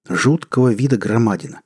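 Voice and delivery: ordinary delivery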